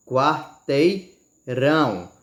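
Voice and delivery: quarteirão bem lento, RÃO destacado; tônica na última. Shown as a demonstration. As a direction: alongar "quarteirão" até apoiar no RÃO final. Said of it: "você não bota mais força para falar". ("quarteirão" pausado, com força no RÃO)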